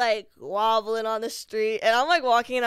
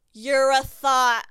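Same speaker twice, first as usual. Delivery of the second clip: slow, loud mock accusation; retold insult; 3 semitones higher, 7 dB louder, much slower than usual. Stranger on the train calls her out, drawn-out mock insult. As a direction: drawl the insult out loudly, imitating him.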